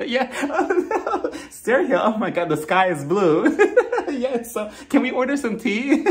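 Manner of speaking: imitating forced, high giggles between lines; mock awkward laughter. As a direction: act out laughing nervously at nothing. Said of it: "very awkward because they start laughing at nothing".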